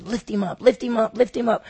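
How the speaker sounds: rising loud mob chant; crowd shout. Acted. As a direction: build it into an angry crowd cry. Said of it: "they actually said".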